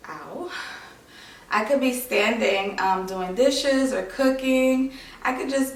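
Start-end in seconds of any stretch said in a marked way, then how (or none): 0.1-0.5 soft ow as the pain peaks; quiet ow. Real, not acted. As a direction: breathe out a small ow. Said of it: "there goes another little".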